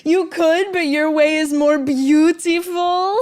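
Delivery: a playful Southern drawl. Acted. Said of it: "bringing out the Southern drawl".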